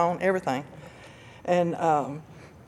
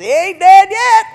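loud, high, drawn-out holler; yelled back; 14 semitones higher, 15 dB louder than usual. Retold or imitated. Husband's best friend to screaming wife from the cart, yelled back. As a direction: call it back from far off.

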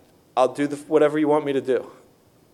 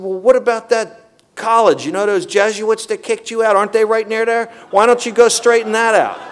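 high, loud, needling suggestion; pushy sponsor; 8 semitones higher, 5 dB louder than usual. Retold or imitated. Sponsor on the phone pushing him to visit the college, high and insistent. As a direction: nudge him toward the next task.

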